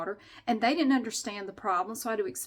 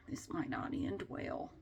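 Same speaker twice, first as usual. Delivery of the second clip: a hushed unvoiced aside; whispered; 9 semitones lower, 13 dB quieter, slower than usual. Shown as a demonstration. Vlogger whispering an ominous aside to her viewers. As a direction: breathe it out like a secret.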